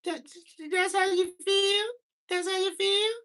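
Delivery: put-on high little kid voice, daring and loud; squeaky kid challenge. Kid confronting again.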